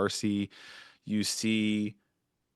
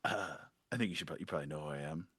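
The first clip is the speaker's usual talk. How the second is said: quick and much softer; hushed aside; 6 semitones lower, 11 dB quieter, much faster than usual. Acted. Companion introducing herself, quick and hushed. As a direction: mutter it quickly under the breath. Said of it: "she goes".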